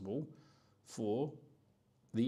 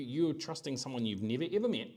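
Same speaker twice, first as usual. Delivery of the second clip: normal talking voice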